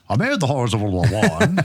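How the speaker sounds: put-on old Hollywood transatlantic voice, half-laughing; transatlantic accent. Shown as a demonstration. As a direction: put on a glamorous old film star accent. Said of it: "do this in a transatlantic voice".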